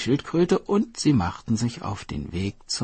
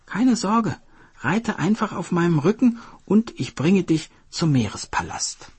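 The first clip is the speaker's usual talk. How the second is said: friendly coaxing turtle, pitched higher; turtle character voice; 11 semitones higher, 4 dB louder than usual. Acted. Turtle luring the hare to the sea palace, coaxing. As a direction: sweet-talk the hare into coming along.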